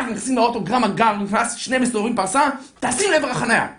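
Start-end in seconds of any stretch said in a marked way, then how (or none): none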